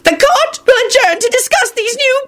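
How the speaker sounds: high, hollering official; hollering undersecretary. A narrator's reading. Undersecretary rants at the court, hollering high. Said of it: "the undersecretary hollered".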